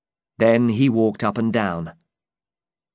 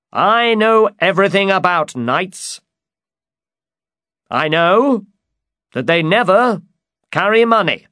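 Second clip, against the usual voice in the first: high, loud, confident knight; emphatic high-pitched storybook knight; Don Quixote; 9 semitones higher, 6 dB louder than usual. Acted. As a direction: declare your expertise grandly. Don Quixote boasts about knights.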